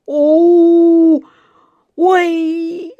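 high, loud howl breaking into "oy"; pained squeal. A narrator's reading. Dentist with his finger bitten, squealing in pain. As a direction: squeal as the bite tightens. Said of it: "взвизгнул мистер Лич".

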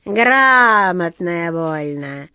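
slow, stretched-out catty jeer; malicious cat's drawn-out taunt; spiteful sneer. A narrator's reading. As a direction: draw the first word out mockingly. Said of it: "злобно усмехнулась чёрная кошка".

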